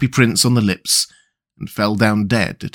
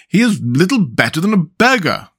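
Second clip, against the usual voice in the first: higher, lightly hammed self-important mayor; pompous mayor; 5 semitones higher than usual. A narrator's reading. Mayor judging the statue, mildly pompous. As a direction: sniff at it like a snob. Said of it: "said the mayor".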